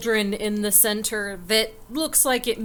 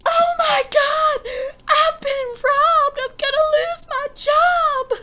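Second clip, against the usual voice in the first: hammed-up crying shriek of despair; panicked wailing; 7 dB louder than usual. Barmaid discovers she has been robbed, hammed-up panicked wail. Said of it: "all of a sudden you hear".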